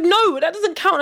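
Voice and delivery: high, mock-defensive protest; retold protest. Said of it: "You shouting at her".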